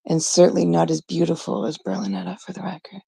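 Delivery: softly whispered, unvoiced dig; whispered aside. Real, not acted. Vlogger whispering a catty aside about a rival's looks. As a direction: whisper it like a catty side comment.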